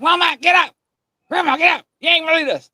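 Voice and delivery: high, loud panicked yelling to wake her; frantic shout. Retold or imitated. Boy yells to wake mama and grandma, frantic shout. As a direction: shout the house awake in alarm.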